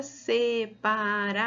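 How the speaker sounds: "se-pa-ra-ção" in measured beats; syllable by syllable. Shown as a demonstration. Teacher introducing a long word, syllable by syllable. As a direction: stretch each syllable of "separação". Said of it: "Mas a gente vai separar".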